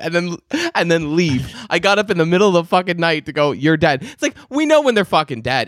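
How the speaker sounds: high-pitched, incredulous comic rant; mock exasperation. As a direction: ridicule it, voice climbing high with amusement. Mocking the absurd job, laughing.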